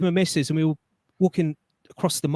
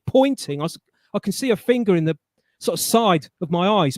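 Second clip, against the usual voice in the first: usual speaking voice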